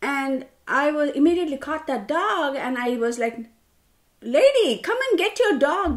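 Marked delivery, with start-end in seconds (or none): 4.2-5.9 high, loud retold outburst; losing it. Dog owner to careless lady, retold angry outburst. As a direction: re-enact an angry yell at a stranger. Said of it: "because I lost it".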